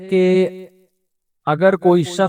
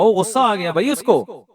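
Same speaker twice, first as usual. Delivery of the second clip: ordinary delivery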